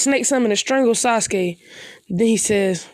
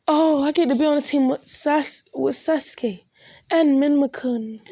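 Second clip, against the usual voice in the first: higher, giddy schoolgirl voice; excited Sakura; 4 semitones higher than usual. Acted. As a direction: gush over the cute boys on the team. Sakura thrilled about her team.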